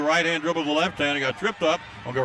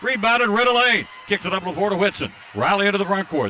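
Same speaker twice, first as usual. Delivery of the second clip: raised, urgent basketball call; higher, driving courtside commentary; excited play-by-play; 5 semitones higher, 4 dB louder, faster than usual. Acted. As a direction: race through the rebound and outlet pass. Live play-by-play burst.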